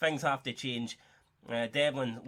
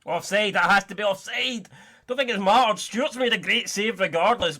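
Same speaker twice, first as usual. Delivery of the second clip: louder, higher, urgent call of a near-miss; excited commentary; 5 semitones higher, 7 dB louder than usual. Real, not acted. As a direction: call the offside appeal with rising alarm.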